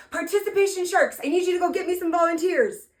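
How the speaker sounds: high, loud call to the helpers; summoning helpers from the stage, loud and high. Retold or imitated. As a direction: call out to the room like a host.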